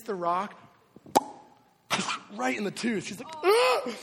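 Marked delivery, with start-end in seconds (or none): 3.4-3.9 high, loud mock scream; short explosive imitation of a fighter's yell; imitated cage-fighter scream. Wife hit in the tooth, imitated loud high scream. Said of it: "I hear her scream like a cage fighter".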